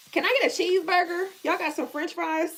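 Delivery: loud, high mock tourist order; high, quick and loud, hammed-up ordering; American tourist ordering. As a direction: play the brash American at a French counter. Voicing American ordering in France.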